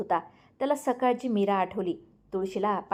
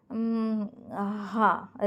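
hesitant hum, then small yes; faltering; much slower than usual. A narrator's reading. Ranjit unsure about the divorce, faltering. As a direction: stall before agreeing. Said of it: "Ranjit अडखळत".

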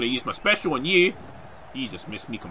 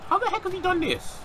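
very high, incredulous question; baffled disbelief. Real, not acted. Gamer baffled by what his opponent did, high incredulous squeal.

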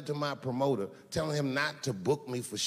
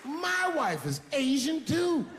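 much higher, slow, taunting; gloating reveal; 13 semitones higher, slower than usual. Acted. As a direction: savor the surprise like a victory lap.

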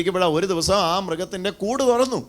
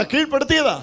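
high, urgent prompt to the crowd; pitched-up congregational call; loud call-out; 8 semitones higher than usual. Shown as a demonstration. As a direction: lead the chant from up high. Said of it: "ഒച്ചത്തിൽ പറ".

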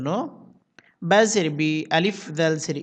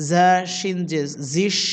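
usual speaking voice

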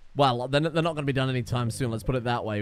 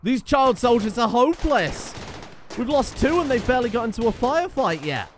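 exasperated rant; 8 semitones higher than usual. Real, not acted. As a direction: rant at your useless soldiers, voice pitched up. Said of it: "Oh, I'm so annoyed".

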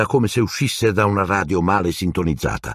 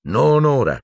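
a firm, slightly louder no; 8 semitones higher, 3 dB louder than usual. A narrator's reading. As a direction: shut it down kindly but firmly. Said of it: "disse Lupin in tono fermo".

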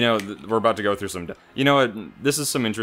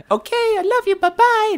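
put-on very high, sweet anime girl voice; squeaky little girl saying goodbye; 8 dB louder than usual. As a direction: chirp it like a tiny cartoon girl. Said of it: "Just like, what's her name from".

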